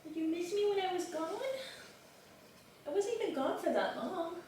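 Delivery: tender high little voice for a beloved pet. Real, not acted. Cooing to her pet.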